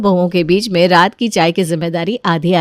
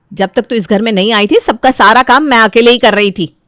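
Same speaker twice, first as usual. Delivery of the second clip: mildly snappish sister-in-law; irritated elder daughter-in-law; 5 semitones higher, 5 dB louder than usual. Acted. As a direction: warn her off, then defend yourself.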